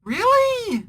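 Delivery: very high, loud squeal; hammed-up falsetto incredulity; mock disbelief. Mimics hero's reaction to thrown apple.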